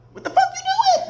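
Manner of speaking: high-pitched incredulous question; shrill-high what are you doing; high squeaky demand. Real, not acted. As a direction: squeak it in disbelief. Repeated rant question.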